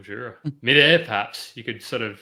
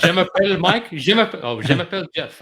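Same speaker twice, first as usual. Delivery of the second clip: loud, high hammed-up French; mock French; 6 semitones higher, 8 dB louder than usual. Joking to the guest, a loud hammed-up French intro. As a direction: ham it up like a cartoon Frenchman.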